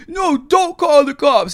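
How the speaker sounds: very high, drawn-out pleading; loud caricature of desperation; homeless man impression. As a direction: beg in a mock panicked wail.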